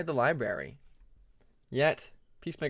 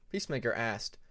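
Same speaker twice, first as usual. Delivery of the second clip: plain reading voice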